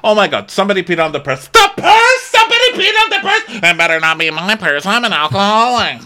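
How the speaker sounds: high, loud overlapping-style shrieks of panic; imitated screams. Mimicking six women reacting to an accident, screaming. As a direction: shriek it like a whole group in hysterics. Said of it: "there's, like, six screams".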